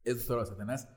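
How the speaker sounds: normal talking voice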